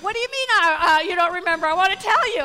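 loud, high, indignant protest; disappointed confessor. Acted. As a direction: protest as if robbed of your confession. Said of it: "some of 'em are disappointed".

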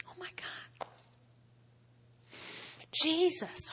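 very soft, breathy awe; barely voiced, moved to tears; real hushed reverence; overcome whisper. Real, not acted. Overcome after recalling crying.

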